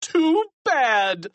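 high, gloating crow; delighted shout. A narrator's reading. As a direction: shout it with nasty glee. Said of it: "Mister Krupp shouted with delight".

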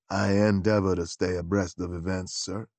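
a low, deferential gentleman's gentleman; valet Beauregard. Acted.

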